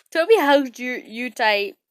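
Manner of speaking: ordinary delivery, nothing marked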